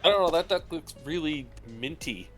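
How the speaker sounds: ordinary delivery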